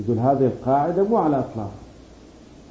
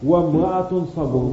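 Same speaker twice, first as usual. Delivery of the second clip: ordinary talk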